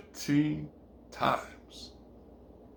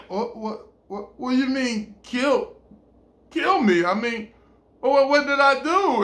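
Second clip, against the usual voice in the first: alarmed; 8 semitones higher than usual. Acted. He reacts to death threat.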